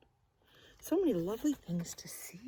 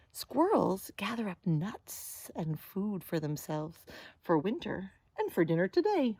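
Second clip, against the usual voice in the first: ordinary delivery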